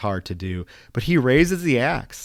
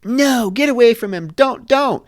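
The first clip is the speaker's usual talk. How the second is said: put-on much higher, loud cry of an old nun warning the boy; frantic nun; 10 semitones higher, 4 dB louder than usual. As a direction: shriek the warning to stop the child. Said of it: "and she's like".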